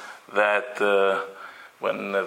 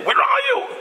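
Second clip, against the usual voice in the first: yelling. Retold or imitated. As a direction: shout at the friend who is late again. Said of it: "You start yelling at the guy".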